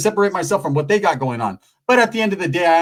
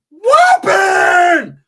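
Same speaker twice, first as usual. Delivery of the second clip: much higher, loud war whoop; battle cry; 13 semitones higher, 8 dB louder than usual. Retold or imitated. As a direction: scream it charging into the fight. Ricky launching into fight.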